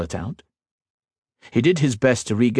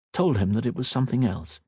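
plain reading voice

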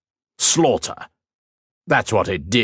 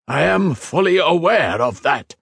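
much higher, tight reply; strained; 9 semitones higher, 3 dB louder than usual. A narrator's reading. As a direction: hold the anger in. Tamar strained.

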